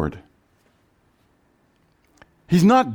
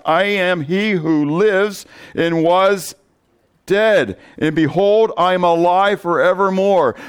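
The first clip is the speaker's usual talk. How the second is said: Bible reading pitched higher, slowing at the end; scripture reading; 4 semitones higher than usual. Acted. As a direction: proclaim the risen Lord's words. Reads scripture aloud.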